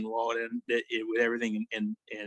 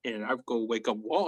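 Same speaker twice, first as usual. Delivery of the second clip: normal talking voice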